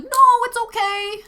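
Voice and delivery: high, loud imitation of an overeager guy; super enthusiastic. Dog owner waving off the walker's warning, super enthusiastic. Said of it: "he was, like, super enthusiastic".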